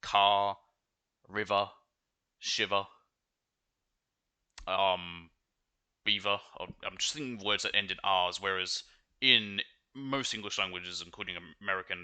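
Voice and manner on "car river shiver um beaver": Australian accent, no R at the ends: car, river, shiver, beaver. Shown as a demonstration. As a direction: drop the final R like an Australian. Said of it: "For example".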